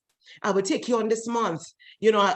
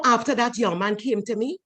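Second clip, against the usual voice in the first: normal talking voice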